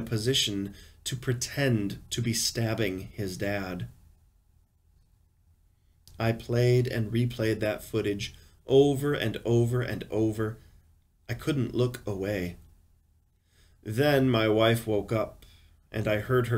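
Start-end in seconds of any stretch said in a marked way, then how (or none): none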